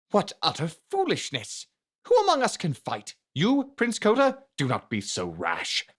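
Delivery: high, sharp scornful cry of disbelief; a nobleman mocking the young prince's plan, scornful and high; scornful outburst. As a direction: scoff at the prince's rash idea, voice leaping up. Fendrick slaps table, scorns Koda.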